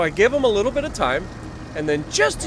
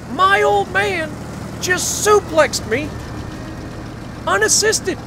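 amazed exclamation; 10 semitones higher, slower than usual. Real, not acted. Son marvelling to viewers at his dad's strength, high and slow. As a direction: stretch out the disbelief.

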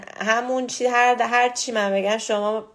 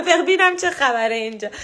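laughing; 10 semitones higher, 4 dB louder than usual. Real, not acted. Laughing on return to live.

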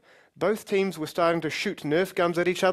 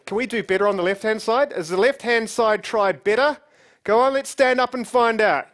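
loud, high challenge to the audience; rallying the crowd; 7 semitones higher, 6 dB louder than usual. Real, not acted. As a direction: whip them up, building to a shouted countdown. Rallying other side to yell.

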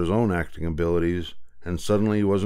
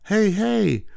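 high, bright distracting chatter; girl voice; 12 semitones higher than usual. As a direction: lure the parents away, girl's voice. Pat distracting parents, girl voice.

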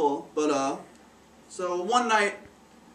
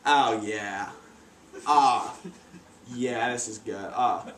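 contented sigh; 8 semitones lower, much slower than usual. Retold or imitated. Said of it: "I'm like".

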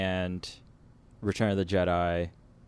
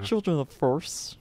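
high Kermit the Frog voice; 7 semitones higher than usual. Retold or imitated. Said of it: "It's like a Kermit accent, maybe?".

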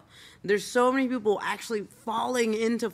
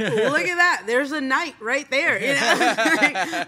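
laughing; 6 semitones higher, 6 dB louder, slower than usual. Real, not acted. Laughs at old photo.

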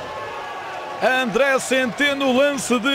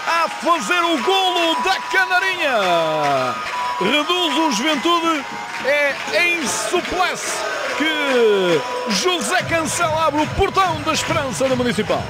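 goal call commentary; 4 semitones higher than usual. Acted. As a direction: shout the goal over the crowd. (relato de golo entusiasmado)

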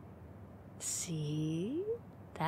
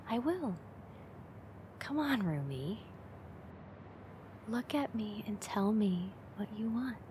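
breathy teasing whisper, close to the ear; 3 semitones higher, 4 dB quieter than usual. Acted. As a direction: lean in and whisper the dare.

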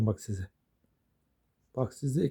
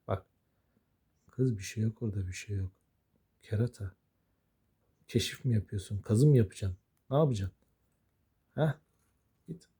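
ordinary talk, nothing marked

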